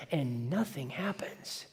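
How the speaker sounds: breathy whisper, no voice at all; whispered letdown. Real, not acted. Preacher to congregation, the prophets' failure, whispered. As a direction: let it fall flat in a whisper. Failure after frantic prayer.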